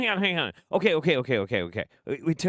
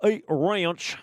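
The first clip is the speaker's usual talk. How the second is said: a hint of a cocky action hero, louder and higher; cocky tough guy; 9 semitones higher, 5 dB louder than usual. Retelling the game hero's quip to listeners, lightly voiced swagger. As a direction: toss off a light one-liner, mock swagger. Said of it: "And Jimmy Payne's like".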